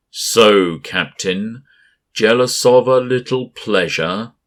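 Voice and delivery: high, loud taunting monster voice; giant mocking voice. A narrator's reading. Gas giant Berenson mocks the captain, loud and taunting. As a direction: taunt like a huge cloud creature.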